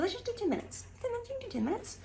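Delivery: high, soft self-encouragement; coaxing pep talk. Retold or imitated. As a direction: coax yourself sweetly. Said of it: "I'll say".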